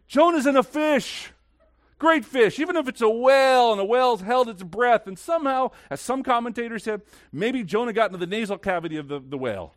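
high, loud pulpit exclamation; impassioned preaching. Real, not acted. Preaches at full force.

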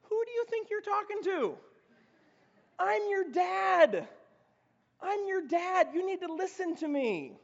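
mock outraged father putting the kid in his place; stern dad. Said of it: "our response will be something like".

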